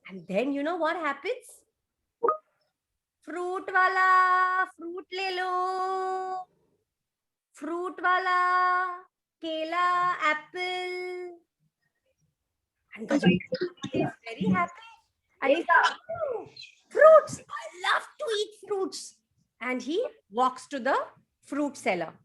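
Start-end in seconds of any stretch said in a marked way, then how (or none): 3.2-11.4 slow, high singsong street vendor call; fruit seller calling. Acted. Vendor calling his wares to the street, long singsong cry. 16.1-19.2 high, loud burst of joy; delighted squeal. Acted. Hungry elephant spotting fruit, high excited cry.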